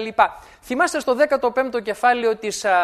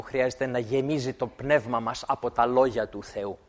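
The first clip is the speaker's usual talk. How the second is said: ordinary talk, nothing marked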